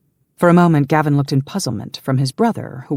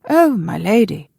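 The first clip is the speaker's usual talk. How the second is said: put-on high, louder old servant taken aback; gasped dismay; 7 semitones higher, 5 dB louder than usual. A narrator's reading. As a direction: exclaim as a mortified old retainer. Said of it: "the old man gasped".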